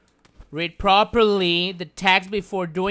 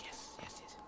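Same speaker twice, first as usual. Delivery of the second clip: greedy whisper; 28 dB quieter than usual. Real, not acted. Greedy gloating.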